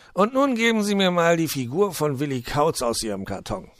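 high, loud, jolly fox voice; high-pitched welcoming fox; cheerful fox. Acted. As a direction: play the hospitable old fox, beaming. Fox voice held.

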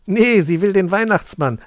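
loud, high, changed voice with a laugh in it; laughing reply. A narrator's reading. Goldlöckchen laughing at the confused Nicholas, loud and high. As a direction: laugh it out at him. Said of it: "lachte Goldlöckchen".

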